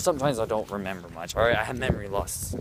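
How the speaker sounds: ordinary delivery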